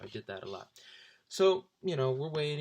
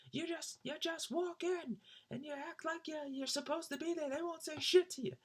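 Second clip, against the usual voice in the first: much higher put-on voice of a brash fan; Brian's advice. Brian tells the guys how he snuck in, high mock voice. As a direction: boast it in a high silly voice.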